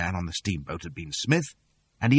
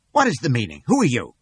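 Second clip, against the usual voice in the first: raised shocked demand; indignant gentleman; 4 semitones higher, 5 dB louder than usual. A narrator's reading. Gentleman confronting intruders, raised shocked demand. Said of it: "cries one of the men".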